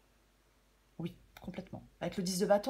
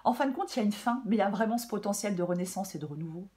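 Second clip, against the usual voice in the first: ordinary delivery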